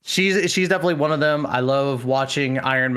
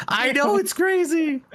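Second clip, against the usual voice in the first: Bao. Retold or imitated. Streamer showing off her head dent, mock scream. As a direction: squeal it in imitation, far above your usual pitch. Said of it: "she starts doing her Bao scream".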